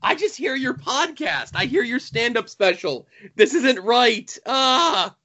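a high, whiny mock rant at the screen; exasperated disbelief. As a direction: complain it just feels wrong. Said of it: "I'm like".

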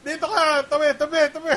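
loud, high yelling to clear the way; playful shouted warning; mock shout. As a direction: bellow at people to move aside. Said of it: "na gaganon ako".

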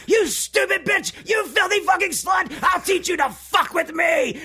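high, loud, slow unhinged shriek; screaming doll rage. Retold or imitated. Fan reenacting Chucky attacking her, high shrieking fury. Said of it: "He's like".

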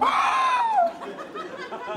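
long high goblin scream; drawn-out mock falling shriek. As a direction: scream all the way down a long drop. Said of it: "You're falling".

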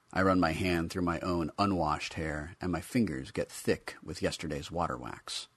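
plain reading voice

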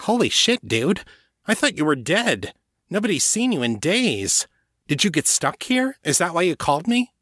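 put-on high, excited young guy's voice. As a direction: blurt it out, amazed. Voicing Rashid.

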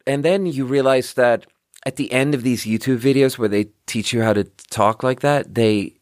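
ordinary talk, nothing marked

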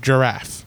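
GIRAFFE starting with a j sound; soft-g giraffe. Shown as a demonstration. Example for his argument, GIRAFFE with soft g. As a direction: say giraffe the standard "juh-raff". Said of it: "the I softens the G".

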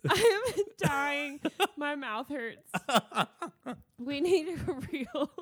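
worn out from laughing; slow words broken up by giggles. Real, not acted. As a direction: talk helplessly through real laughter. Cracking up after her own performance.

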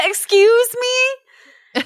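very high, drawn-out offended excuse me; mock offended. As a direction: play scandalized at the beetle's nerve.

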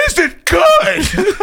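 very high, drawn-out mocking shriek; incredulous squealed repeat; outraged echo. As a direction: shriek the question back, stretched and loud. Incredulous echo of wife's question.

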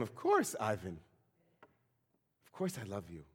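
mild surprise settling into quiet assurance; somewhat surprised. Retold or imitated. Igor answers his drunken friend's question, mildly surprised. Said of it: "somewhat surprised".

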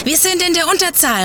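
high urgent call. A narrator's reading. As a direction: call out across the battle. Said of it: "rief Josh".

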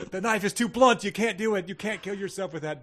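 high-pitched acted protest, fading in volume; mock pleading. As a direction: call out a farcical warning to the widow. Voices bystander to wailing widow.

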